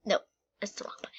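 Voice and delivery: fast whispered correction to self; rushed hushed aside. Real, not acted. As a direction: hiss it quickly under your breath. Muttered aside while playing.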